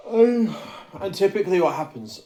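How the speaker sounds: high loud booming ogre voice. Real, not acted. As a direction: boom it out like a dim ogre. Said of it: "I sounded like a fucking ogre then".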